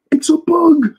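gasp, then a very high alarmed squeal; startled Usopp. Retold or imitated. Usopp spotting the bug, gasping at a very high pitch. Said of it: "the way Usopp just says".

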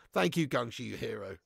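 ordinary talk, nothing marked

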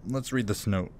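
ordinary talk, nothing marked

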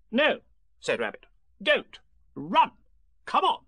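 high, loud, bossy Rabbit; urgent commands. A narrator's reading. Rabbit hurries his friends away, urgently bossy. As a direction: cut in with high, urgent orders.

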